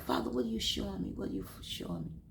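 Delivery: a hushed, hurried prayer that trails off. Retold or imitated. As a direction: ask the Lord quietly, drifting to a whisper. Retelling the prayer a second time.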